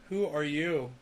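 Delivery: ordinary talk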